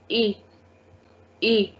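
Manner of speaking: "A" said as a clear ay; accented E sound. Shown as a demonstration. Teacher showing E with accent aigu, "A" as ay. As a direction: say "A" as French é. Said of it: "if this accent is over E".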